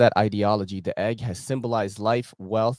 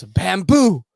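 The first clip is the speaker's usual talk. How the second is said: high, loud mock shout of "Bamboo!"; loud high playful shout; shouted punchline; 10 semitones higher, 9 dB louder than usual. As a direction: yell it like a joke's punchline. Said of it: "And the girl goes".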